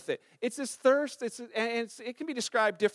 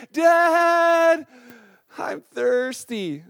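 put-on a child's far-off shout of Dad, then a slow, drawn-out I'm thirsty; child calling out; 2 semitones higher, 8 dB louder, much slower than usual. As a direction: call from bed like a thirsty kid. Child calling from bed at night.

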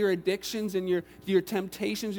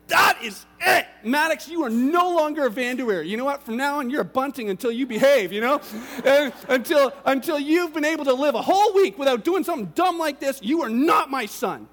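loud, higher-pitched hammed-up scolding; mock furious father; 4 semitones higher, 6 dB louder than usual. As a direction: lay down the law like an outraged dad. Acts out disowning his son angrily.

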